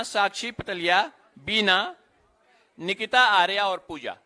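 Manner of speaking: measured announcer voice, each name set apart; roll-call announcer. Real, not acted. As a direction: call out names at a ceremony.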